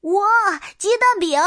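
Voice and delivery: put-on high, delighted child's cry; happy exclamation. A narrator's reading. Child sees egg pancakes at the meal, high happy exclamation. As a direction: exclaim in high-pitched glee. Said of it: "我开心地说着".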